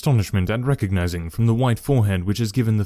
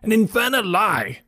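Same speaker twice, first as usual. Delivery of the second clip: high outraged denial; furious shout; 10 semitones higher than usual. A narrator's reading. Trainer shouts at Holmes's whispered accusation, high and furious. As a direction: explode at the accusation. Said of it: "he shouted".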